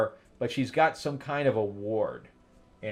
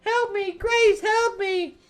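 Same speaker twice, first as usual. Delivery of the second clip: put-on very high small-girl cry for help; little girl pleading. Little girl calling Grace for help, very high. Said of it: "And she's like".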